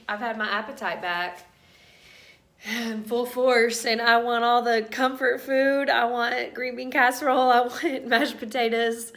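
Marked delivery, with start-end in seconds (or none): 2.7-9.1 loud, raised, insistent listing; emphatic craving. Real, not acted. Pregnant woman with appetite back listing comfort food, loud and emphatic. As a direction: belt out each craving with gusto.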